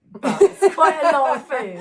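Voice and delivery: loud, high, laughing through the words; amused and laughing out loud; real laughing. Real, not acted. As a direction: crack up as you say it. Both laugh at the joke.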